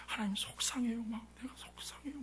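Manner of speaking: near-whispered lament to God; retold tearful prayer. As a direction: imitate weeping alone, very hushed. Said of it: "막 울었어요". (속삭이듯 울먹이는 기도 흉내)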